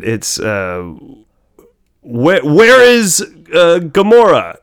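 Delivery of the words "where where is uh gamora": loud, slow movie quote; quoting the movie. Retold or imitated. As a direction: call it out slowly. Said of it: "what was it?".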